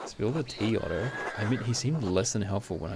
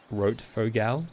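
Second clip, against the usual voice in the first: broad Australian accent; 3 dB louder, much slower than usual. Shown as a demonstration. Streamer trying out a name, broad Australian accent. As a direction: lay the Australian accent on thick. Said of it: "in the most Australian way possible".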